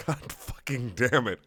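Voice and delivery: plain reading voice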